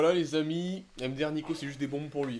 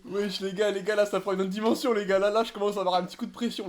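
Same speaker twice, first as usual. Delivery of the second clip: excited; 6 semitones higher, 6 dB louder than usual. Real, not acted. The heat builds after the sauce.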